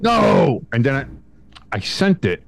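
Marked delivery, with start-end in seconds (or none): 0.0-0.6 a high mock shout of no; retold no. Customer repeating the refusal to the agent, high mock shout. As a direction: shout it again, a little lighter. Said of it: "I went".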